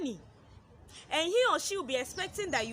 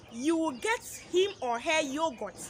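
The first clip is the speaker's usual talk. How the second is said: normal talking voice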